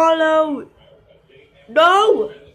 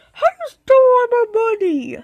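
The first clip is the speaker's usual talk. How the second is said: high, fast, whiny accusation; mock accusing cry; 9 semitones higher, 3 dB louder, faster than usual. Robbed figure wailing at the thief, high and fast. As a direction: wail it out fast, wronged.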